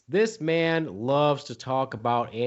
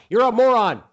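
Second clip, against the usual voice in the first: loud, higher jeering shout; shouted insult; 8 semitones higher, 7 dB louder than usual. Acted. President shouting an insult at his debate rival. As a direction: yell the insult across the stage.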